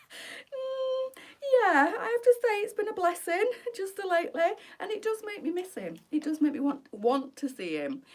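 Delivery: choking up, pushing the words out through crying; tearful. Real, not acted. Telling viewers she misses her husband, tearful and breaking. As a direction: voice breaking high as she fights back tears. Emotional talk about missing husband.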